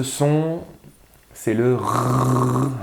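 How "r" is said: "r" as a long French uvular rasp; isolated r sound. Shown as a demonstration. Teacher showing the last sound, sustained "r". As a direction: hold the sound "r" without naming the letter. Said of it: "doit entendre r au début".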